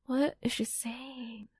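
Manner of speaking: slow whisper to herself; slow, baffled whisper. Retold or imitated. Retells her confusion at the customer.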